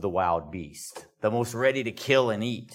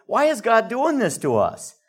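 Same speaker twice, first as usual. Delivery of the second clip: high-pitched mock protest; acted-out complaint, pitched well up; 10 semitones higher, 4 dB louder than usual. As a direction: plead the grievance in a raised voice. Acting out the people's complaint.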